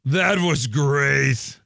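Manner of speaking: very slow, drawn-out praise; imitating a commentator, dragged out; mock JBL commentary. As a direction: stretch each word in a commentator impression. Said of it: "J B L says".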